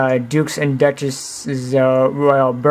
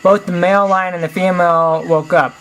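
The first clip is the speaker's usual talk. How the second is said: ordinary delivery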